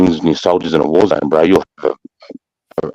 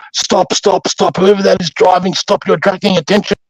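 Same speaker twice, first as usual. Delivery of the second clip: put-on much higher, Dutch-accented voice barking orders over a loudhailer; Dutch loudspeaker warning; 12 semitones higher than usual. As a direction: imitate a Dutch soldier on the emergency speaker, much higher. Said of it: "Dutch guys going on the emergency loud howlers".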